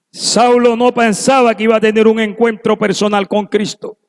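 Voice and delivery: ordinary talk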